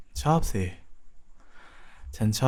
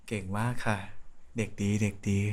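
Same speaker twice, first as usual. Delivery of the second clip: soft praise; 7 dB quieter than usual. Acted. Praising partner who stopped squirming.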